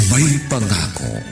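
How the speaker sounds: normal talking voice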